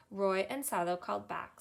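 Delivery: ordinary delivery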